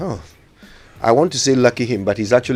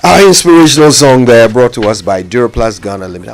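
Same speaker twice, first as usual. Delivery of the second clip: ad read; 9 dB louder than usual. Acted. Sponsor announcement read.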